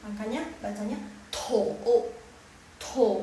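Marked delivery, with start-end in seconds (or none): 1.3-3.1 "to" as tou, falling then climbing; third tone. Shown as a demonstration. Teacher explains third tone, down then up. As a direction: dip "to" down before raising it. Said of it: "turun dulu, baru naik lagi".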